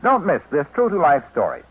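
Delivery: ordinary delivery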